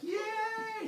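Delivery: very high, stretched squeal; mock childlike glee; naive cheer. Dim humans cheer.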